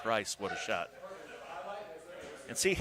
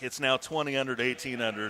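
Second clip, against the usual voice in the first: ordinary talk, nothing marked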